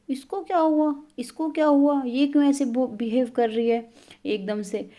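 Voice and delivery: high-pitched mock bewilderment; retold puzzled complaint. Acting out Rinku's puzzled complaint.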